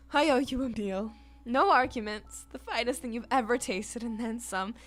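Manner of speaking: loud, grand showman voice; booming Quinn. Acted. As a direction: boom it out like a proud chef covering sadness. Voicing Quinn the chef.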